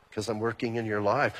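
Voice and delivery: low, soft, calm divine reply; God's patient answer. Retold or imitated. God answering a complaining believer, low and calm. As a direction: answer gently like a patient father.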